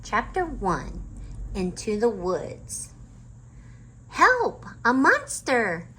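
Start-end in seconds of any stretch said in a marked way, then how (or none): 4.1-5.9 high, loud cry of alarm; little sister yelling in mock fright; excited shout. A narrator's reading. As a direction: Annie shouting about a monster. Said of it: "said Annie".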